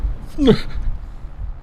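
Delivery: breathless wheezy laugh; pained laugh. Real, not acted. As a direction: laugh through a sour face. Reacting to sour candy.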